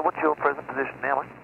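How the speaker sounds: usual speaking voice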